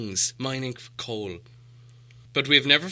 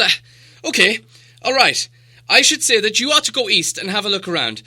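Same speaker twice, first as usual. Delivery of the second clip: much higher and louder, stammering and tripping over words; flustered; 10 semitones higher, 8 dB louder than usual. A narrator's reading. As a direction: babble nervously, repeating words in a panic. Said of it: "he was so flustered".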